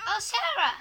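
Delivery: high, loud exclamation; surprised greeting. Acted. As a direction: cry out at seeing an old friend. Greets long-unseen friend.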